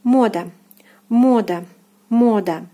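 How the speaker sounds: Мода with a full open O in the first syllable; Russian stressed O. Shown as a demonstration. Teacher models Мода for students, clear stressed O. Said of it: "O is stressed here".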